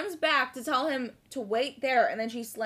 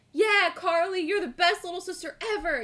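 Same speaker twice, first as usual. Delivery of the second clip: genuinely supportive; 6 semitones higher than usual. Retold or imitated. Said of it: "He said it in a really genuine".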